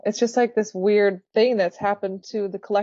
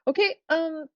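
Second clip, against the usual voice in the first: higher, rushed interview goodbye; hurried sign-off; 6 semitones higher than usual. Retold or imitated. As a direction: mimic rushing off the call. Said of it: "It's like".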